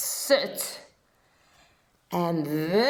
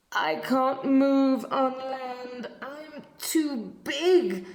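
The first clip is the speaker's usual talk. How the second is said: moaning whale; 6 semitones higher than usual. Acted. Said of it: "moaned the whale".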